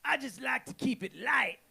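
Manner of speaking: high New Orleans character voice; mock New Orleans street philosopher, high-voiced; Shorty's New Orleans accent. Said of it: "Shorty was from New Orleans".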